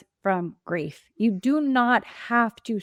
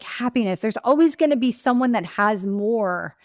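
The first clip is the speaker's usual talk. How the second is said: ordinary talk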